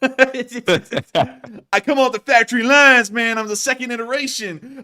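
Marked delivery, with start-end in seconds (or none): 0.0-3.1 slow, loud, laughing joke. Real, not acted. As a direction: crack up while saying it.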